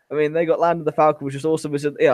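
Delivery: usual speaking voice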